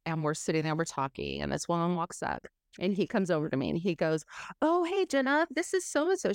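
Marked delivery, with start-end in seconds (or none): 4.6-6.3 high, bright mock-cheery greeting, pitched well up; imitating his falsely casual hello; husband's breezy intro. Husband introducing the liquor rep, mock-cheery and high.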